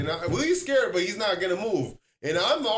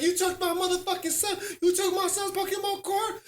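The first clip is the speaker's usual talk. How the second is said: high-pitched accusing mother; angry mom; 12 semitones higher than usual. Retold or imitated. A mom confronts the kid who took her son's card, high-pitched and accusing.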